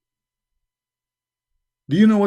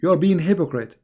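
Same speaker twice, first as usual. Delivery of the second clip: ordinary talk, nothing marked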